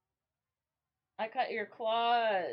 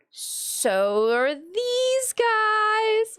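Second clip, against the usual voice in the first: slow, high, drawn-out comeback; mock retort; 10 semitones higher, 6 dB louder, much slower than usual. Teen self answering mom, slow and high. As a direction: drag it out high and smug. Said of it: "I was like".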